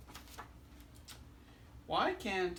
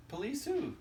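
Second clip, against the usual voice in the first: much higher ask; high questioning voice; 12 semitones higher than usual. Acted. Answering the knock-knock joke, high and questioning. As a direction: lift it right up on who.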